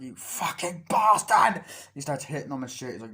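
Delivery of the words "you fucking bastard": high, loud, drawn-out curse; enraged attacker's yell; acted furious shout. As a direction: roar it slowly at someone you are attacking. Attacker cursing in retold fight.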